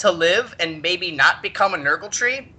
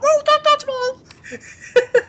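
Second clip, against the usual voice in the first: high, slow, through a burst of laughter; cracking up while yelling it; squealing with laughter; laughing shout; 4 dB louder, much slower than usual. Real, not acted. Laughing at own silly song.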